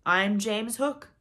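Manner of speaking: loud, slow pirate captain; Hook's voice. A narrator's reading. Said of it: "answered in Hook's voice".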